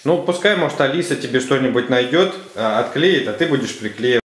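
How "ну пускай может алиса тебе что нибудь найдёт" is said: ordinary talk, nothing marked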